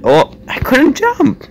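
high, slow whining wail; whiny complaint. Real, not acted. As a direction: whine like it's unfair. Fails jump again, complaining.

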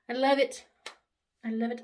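normal talking voice

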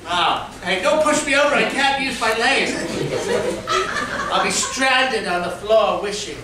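ordinary talk

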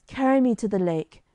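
Arthur, dying, begs his knight for the lake; entreating. Acted. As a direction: beg the knight to carry him. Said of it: "entreated Arthur".

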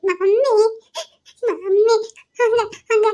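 put-on sobbing little child; crying. Child trying to tell mother what happened, crying. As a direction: gasp the words out between sobs. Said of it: "ஏன் இப்படி அழுகுறா?".

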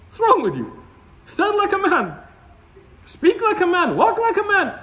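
mock scolding. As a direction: rebuke him to act like a man. Scolding a weak brother.

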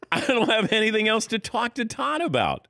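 high laughing voice. Retold or imitated. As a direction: laugh through the thought. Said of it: "And I went".

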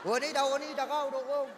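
a hint of a high, stoned street guy. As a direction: play the stoned slacker lightly. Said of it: "hiciera el papel de volado".

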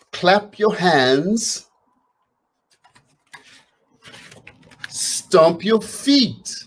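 slow, sing-song chant to the beat; slow, bouncy call to move along; rhythmic read-aloud. Acted. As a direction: lead the children through the actions. Reads drum book rhythmically aloud.

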